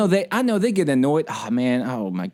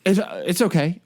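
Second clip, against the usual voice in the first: startled; 3 semitones higher than usual. Retold or imitated. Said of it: "She's like, startled".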